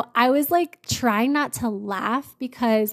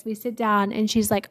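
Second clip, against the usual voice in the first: ordinary talk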